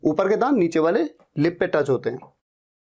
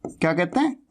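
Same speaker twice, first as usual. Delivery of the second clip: ordinary talk, nothing marked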